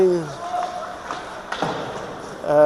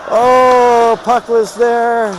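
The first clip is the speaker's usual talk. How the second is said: excited; 5 semitones higher, slower than usual. Real, not acted. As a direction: call it like the puck's bouncing everywhere. Scramble in front, near miss.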